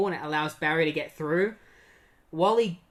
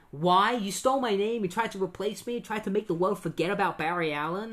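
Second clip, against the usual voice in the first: accusing; 5 semitones higher than usual. Retold or imitated. Barry accuses Wally.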